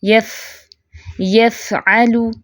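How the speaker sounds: यफ़अलु slow, with a long airy f; breath flowing. Shown as a demonstration. Teacher shows breath continuing on fa, long f. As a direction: keep air running on the f of यफ़अलु. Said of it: "सांस भी जारी होगा".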